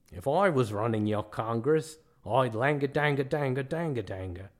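mock Australian accent melting into gibberish; terrible Australian accent. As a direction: do a bad Australian accent, slurring into nonsense. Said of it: "I know my Australian accent is terrible".

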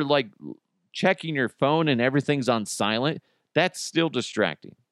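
ordinary talk, nothing marked

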